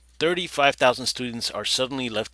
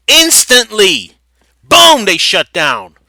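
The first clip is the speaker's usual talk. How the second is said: emphatic; 7 semitones higher, 14 dB louder, slower than usual. Real, not acted. Emphasizing a sudden shutdown.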